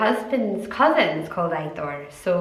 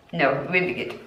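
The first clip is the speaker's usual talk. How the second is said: normal talking voice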